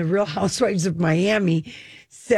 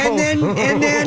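high, loud eager rush; tumbling out the words, thrilled; 7 semitones higher, 4 dB louder than usual. Real, not acted. Excited retelling of funny scene.

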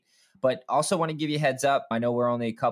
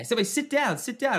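put-on much higher, commanding little-kid voice; bossy toddler voice; 13 semitones higher than usual. Dad imitating his toddler bossing him to sit. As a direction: order everyone to sit like a tiny boss. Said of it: "son telling him what to do".

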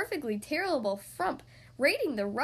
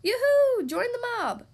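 much higher, much louder call; shouted yoo-hoo; 10 semitones higher, 7 dB louder than usual. Acted. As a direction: holler it across the savanna. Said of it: "the other four shouted".

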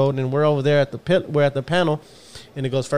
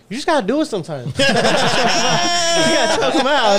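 laughing friend quote; 11 semitones higher than usual. Retold or imitated. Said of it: "he's like".

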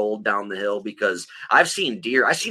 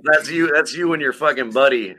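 ordinary delivery

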